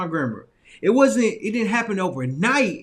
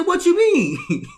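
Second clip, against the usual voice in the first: laughing confusion; 8 semitones higher, 5 dB louder, much slower than usual. Retold or imitated. Confused reply to mentor.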